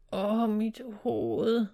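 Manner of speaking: slow, drawn-out groan of pain; dazed and aching, words dragged out. A narrator's reading. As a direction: groan it as if just coming round. Injured guard coming round, in pain.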